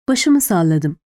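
plain reading voice

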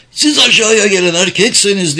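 high, loud furious cry; hateful yell. A narrator's reading. Galta dares the men above to come down, hateful yell. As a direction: scream a challenge up at the enemy. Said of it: "diye nefretle haykırdı Galta".

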